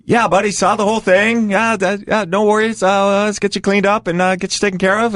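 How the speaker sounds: high, placating patter; mock reassuring. Acts out humouring the bloodied fighter.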